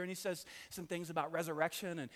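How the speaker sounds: ordinary talk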